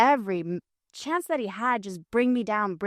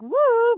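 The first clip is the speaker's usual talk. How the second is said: high, drawn-out "woo"; playful crazy whoop; 7 dB louder than usual. Acted. Host joking that media people are all a little crazy, high whoop. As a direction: let out a loopy high whoop.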